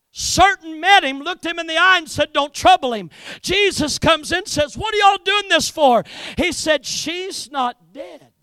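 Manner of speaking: high, loud sermon delivery. Real, not acted. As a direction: preach the story with force, easing at the end.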